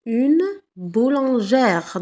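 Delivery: very slow "boulangère", open "-ère"; teacher modelling the feminine; slow teaching demo. Shown as a demonstration. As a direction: drag out "boulangère". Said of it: "Au féminin".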